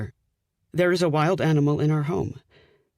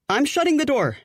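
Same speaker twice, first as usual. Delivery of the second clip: high urgent exclamation; exclaiming; 11 semitones higher than usual. Acted. Fiancée announces shutting door on bird, high urgent. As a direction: announce it sharply, rattled. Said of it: "I exclaim".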